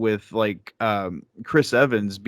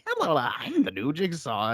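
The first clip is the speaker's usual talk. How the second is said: high-pitched, whiny, softer villain impression; 4 semitones higher, 6 dB quieter than usual. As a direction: mimic a thin whiny recorded villain. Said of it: "high-pitched, like, whiny voice".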